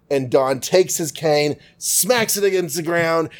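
ordinary talk

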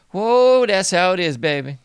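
loud high whoop, then settling; excited whoa. Real, not acted. Riffing to viewers, a loud high excited whoop.

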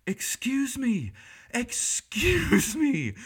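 put-on British accent, interrupting the room with a high, indignant call. British moderator bursting in, British accent, high and loud. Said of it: "with a great British voice".

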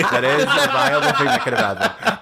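laughing through the words. Real, not acted. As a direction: giggle while saying it. Jokes about Arkansas cousin.

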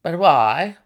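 loud, startled question; astonished gasp. Acted. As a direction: gasp it out in amazement. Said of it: "I gasped".

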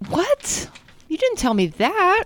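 high-pitched real disbelief; taken aback. Real, not acted. Co-host wasn't told about the genre, taken aback. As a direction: protest in surprise, voice shooting up.

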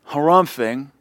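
ordinary talk

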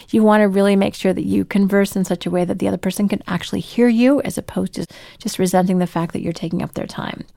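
ordinary talk, nothing marked

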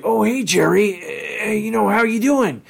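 high, halting, friendly dopey voice; a sweet goof making small talk; Doofus Rick voice. Retold or imitated. Podcaster imitates Doofus Rick greeting Jerry, high and gentle. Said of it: "this one with, uh, Jerry's like".

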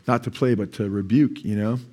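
ordinary talk, nothing marked

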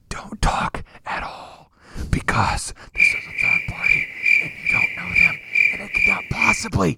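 mock hushed voice of the awkward silence; lightly voiced whisper of the doom cloud; cloud's hushed warning. Host voicing the silent cloud to his co-host, mock hush. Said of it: "a cloud that says".

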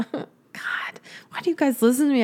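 tearful half-laughing whisper; choked up with happy tears, barely voiced; getting emotional. Real, not acted. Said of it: "now I'm getting emotional".

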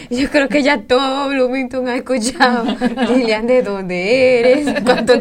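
laughing. Real, not acted. Host still laughing, greeting Lilian.

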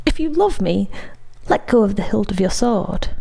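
plain reading voice

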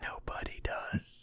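slow hushed whisper. Pretend. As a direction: breathe it close to the mic. Mic gag aside to listener.

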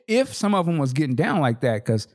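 usual speaking voice

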